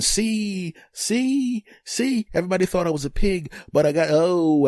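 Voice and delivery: high, excited self-defence; defensive dad. Retold or imitated. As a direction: protest like a caught-out dad. Dad defending himself to family.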